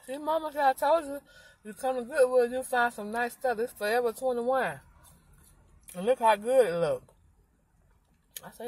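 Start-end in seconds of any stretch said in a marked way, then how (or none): 0.0-7.0 daughter's voice. Retold or imitated. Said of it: "She said".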